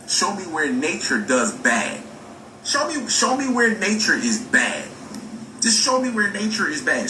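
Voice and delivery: high-pitched, insistent demand; insistent challenge. Real, not acted. Speaker challenging doubters to prove nature is bad, insistent. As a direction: press the challenge, pitched well up. Repeated challenge to an imagined objector.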